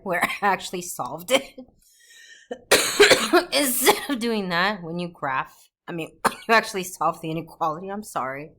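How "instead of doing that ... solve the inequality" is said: talking through a cough; coughing between words; coughing fit. Real, not acted. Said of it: "I'm coughing so much".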